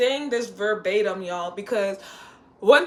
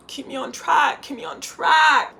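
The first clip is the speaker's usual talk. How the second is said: slow, louder reminder; mock shout to self; 6 semitones higher, 5 dB louder, slower than usual. Real, not acted. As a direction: call yourself back to the topic. Telling herself to focus.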